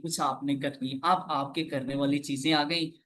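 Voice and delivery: ordinary delivery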